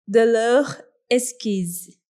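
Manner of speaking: slow, careful French accent; French pronunciation. Real, not acted. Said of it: "foi sexy".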